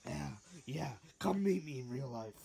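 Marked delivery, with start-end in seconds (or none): 1.2-2.3 loud challenge. Acted.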